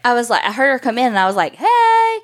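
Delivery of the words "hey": loud, high, drawn-out hey; calling out. Retold or imitated. Friend calling out as she comes in, hollering. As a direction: holler a greeting through the house. Calls out greeting in story.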